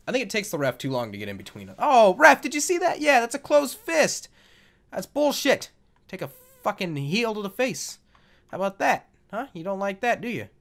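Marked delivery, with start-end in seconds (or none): 1.8-8.0 loud, high complaint at the ref; outraged protest. Pretend. Player protesting a closed fist to the referee, loud mock outrage. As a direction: yell foul in mock outrage.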